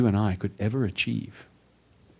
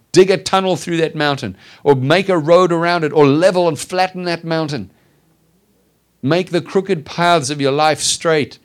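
full-force preaching; 4 semitones higher, 5 dB louder than usual. Acted. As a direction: preach it out, rousing the flock.

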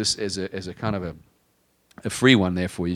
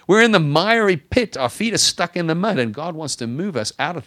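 higher and louder, building preacher's cry; preaching at full force; 8 semitones higher, 6 dB louder than usual. Acted. Pastor urging the congregation out of their mess, preaching at full force.

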